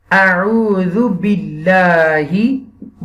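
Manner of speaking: اعوذ باللہ drawn out very slowly, each word separate. Shown as a demonstration. Tutor models اعوذ باللہ for a child to repeat, very slowly. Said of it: "آپ نے کہنا ہے".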